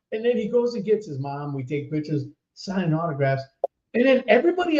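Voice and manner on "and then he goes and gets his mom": normal talking voice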